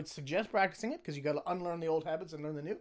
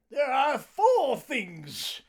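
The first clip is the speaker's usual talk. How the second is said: Monty Python quote; 7 semitones higher, 7 dB louder, much slower than usual. Pretend. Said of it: "Monty Python quote".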